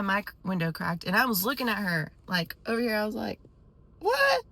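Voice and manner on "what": high squeal of disbelief; shocked disbelief. Retold or imitated. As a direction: squeak it out, appalled. Acted shocked reaction to the yelling.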